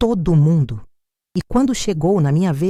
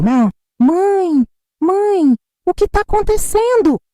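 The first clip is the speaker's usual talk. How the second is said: panicked screaming; 13 semitones higher, slower than usual. Acted. Daughter screams as her mother collapses, high panicked cries. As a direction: shriek for your mother in terror. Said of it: "A Laura gritando".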